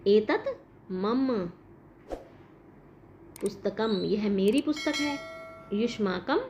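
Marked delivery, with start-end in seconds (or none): none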